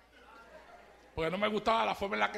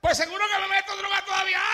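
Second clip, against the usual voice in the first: higher, louder, fast taunt with a laugh; mocking back; 10 semitones higher, 6 dB louder, faster than usual. Preacher taunts the mocker back, high, fast and laughing. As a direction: turn the laugh on him.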